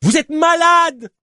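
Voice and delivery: high, loud, drawn-out yell; outraged shout. Acted. Cyclist to journalist amid the desert storm, outraged yell. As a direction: yell at him as if he's mad. Woman calls journalist crazy.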